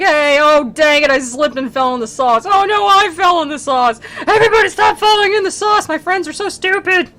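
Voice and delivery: high, loud, flustered cries; goofy breadstick demons. Retold or imitated. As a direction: panic over falling in the sauce. Breadstick demons slipping in sauce.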